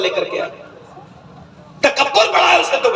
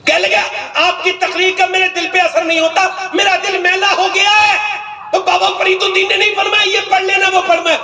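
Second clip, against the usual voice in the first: preaching at full force; 9 semitones higher than usual. Acted. Preacher telling the congregation Baba Farid's advice, ringing sermon shout. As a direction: drive the moral home, voice raised high.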